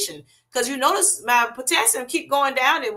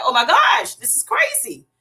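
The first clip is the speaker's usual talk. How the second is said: mock alarm; 7 semitones higher, 4 dB louder than usual. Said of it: "I'm like".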